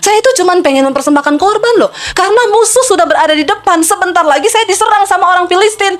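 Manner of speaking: high-pitched, protesting self-defence; lightly voiced Saul. King Saul defends his offering to God, lightly acted protest. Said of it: "Saul mungkin bilang".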